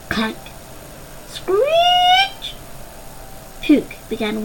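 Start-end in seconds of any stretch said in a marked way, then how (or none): 1.3-2.5 high, long screeching noise; metal screech. Acted. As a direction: imitate squealing metal. Sound effect of metal.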